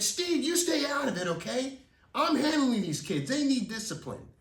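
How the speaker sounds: high, loud rebuke; angry neighbor. Retold or imitated. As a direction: tell him to back off. Rick snapping at Steve.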